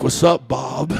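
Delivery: low, cool face-off greeting; retold tough, understated standoff; low-key challenge. Acted face-off with neighbor Bob.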